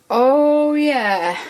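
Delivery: loud, drawn-out American "Oh yeah"; American accent. Pretend. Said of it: "That's my useless American accent".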